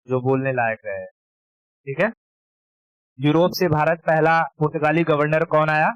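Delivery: normal talking voice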